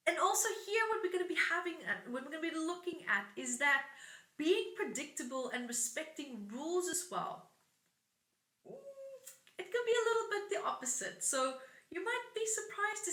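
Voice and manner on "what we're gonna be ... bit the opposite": usual speaking voice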